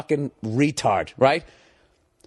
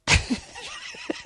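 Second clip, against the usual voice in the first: mock laugh; 5 dB quieter than usual. Derisive laugh at seatmate.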